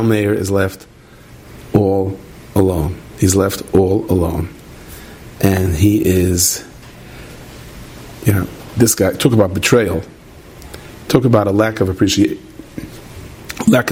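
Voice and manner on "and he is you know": ordinary talk, nothing marked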